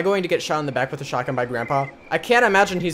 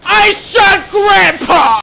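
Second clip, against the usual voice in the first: slow, drawn-out high yell; very loud, high crowing; triumphant shout; 15 dB louder, much slower than usual. Real, not acted. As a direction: scream it in victory, each word stretched. Triumphant yell after shooting.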